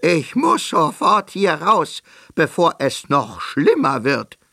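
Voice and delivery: upset frog yelling to escape; frog shouting. A narrator's reading. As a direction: cry out in despair, slowing at the end. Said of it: "schrie er".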